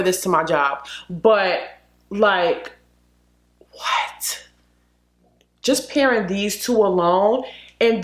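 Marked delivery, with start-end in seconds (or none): none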